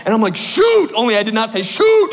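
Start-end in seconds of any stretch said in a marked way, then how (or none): 0.4-0.9 loud, high yelp of dismay; panicked exclamation. Retold or imitated. 1.6-2.1 loud, high-pitched mock curse; panicked exclamation. Repeats the acted exclamation.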